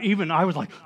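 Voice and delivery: normal talking voice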